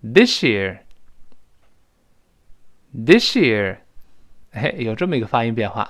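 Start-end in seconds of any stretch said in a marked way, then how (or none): 0.0-3.8 "this year" with s turned to sh; linked "this year". Shown as a demonstration. Teacher leads learners in fast form, "this year" linked. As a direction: merge "this year" into "thi-shear". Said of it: "读快了之后变成".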